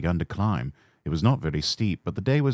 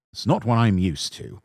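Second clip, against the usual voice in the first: ordinary delivery, nothing marked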